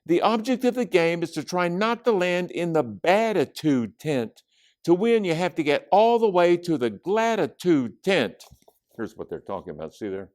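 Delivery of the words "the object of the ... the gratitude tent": put-on high, animated young girl voice; Judy explaining her game. A narrator's reading. Judy explains the board game to friends, high and eager. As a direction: pitch the rules up high and eagerly.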